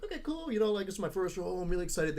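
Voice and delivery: high eager newcomer; chirpy first-role excitement; mock excited. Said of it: "I'm like".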